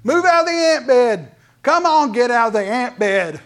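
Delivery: loud, high-pitched urgent calls; God pleading loudly with a stubborn child to move; God urging. Retold or imitated. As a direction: shout him out of the ant bed. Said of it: "And God's going".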